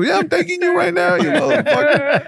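cheeky high-pitched snap back; high mock retort. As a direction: fire back high and playful. Retold retort to friend.

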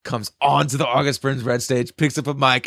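ordinary delivery, nothing marked

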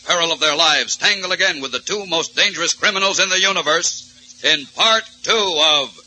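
booming high radio promo voice; old radio announcer. Acted. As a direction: sell the next thrilling episode.

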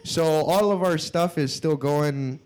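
high put-on Minnesota clerk voice, laughing; Midwestern clerk. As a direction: play the sheepish clerk with a thick Minnesota accent. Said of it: "And they're like".

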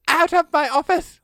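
high-pitched, hammed-up indignation; mock outrage. Boss orders the scientist out of the office, mock-outraged. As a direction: throw them out in a high mock fury.

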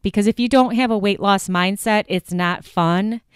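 ordinary talk

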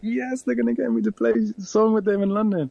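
high-pitched, thrilled burst; gleeful, like winning a dream chance; retold excitement, pitched well up; excited inner cheer. Retold inner delight at big chance.

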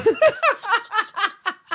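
shrill burst of laughter; helpless high-pitched laugh; high squealing laugh. Real, not acted. Laughing at fart sounds.

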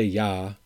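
"yeah" with an open ah, as "yah"; "yeah" turned into a rural "yah"; Upper Midwest "yah". Shown as a demonstration. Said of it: "I don't say".

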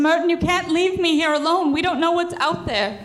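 wife pleading in fear; frightened pleading. Acted. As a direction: beg him not to leave her.

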